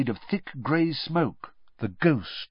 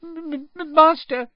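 very high stammering elf voice; stammer, pleading through tears; stammering tearful elf; 6 dB louder than usual. A narrator's reading. House-elf stammers up at her master, in tears. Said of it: "Winky stammered".

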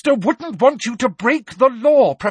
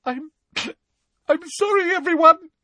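halting, very high tearful apology; desperate high-pitched blubbing; sobbing; 4 dB louder than usual. A narrator's reading. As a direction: sob it out with catches between words. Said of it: "Hermione was sobbing desperately".

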